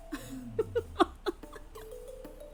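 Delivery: high, soft giggle; amused little high laugh; giggling. Acted. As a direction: titter quietly at his bravado. Demon laughs at his bravado.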